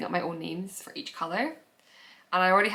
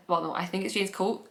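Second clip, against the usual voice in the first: ordinary talk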